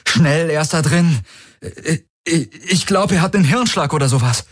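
urgent fake shock; feigned alarm. Valur to Tari's guards, stammering fake alarm.

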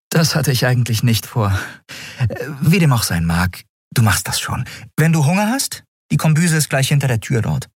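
mildly practical captain; easygoing captain. A narrator's reading. Captain reassures new boy.

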